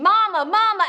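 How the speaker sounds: high, loud, desperate scream. Acted. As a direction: scream out for your mother. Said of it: "we's a screaming".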